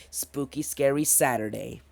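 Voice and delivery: ordinary delivery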